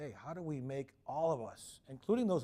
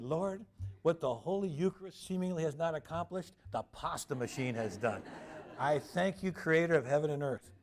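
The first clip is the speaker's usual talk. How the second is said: lightly solemn, louder thanksgiving to God; half-joking grand invocation; mock prayer; 3 semitones higher, 3 dB louder than usual. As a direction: pray it with tongue in cheek.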